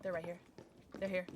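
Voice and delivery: usual speaking voice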